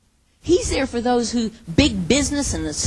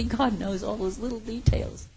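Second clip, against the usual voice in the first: ordinary talk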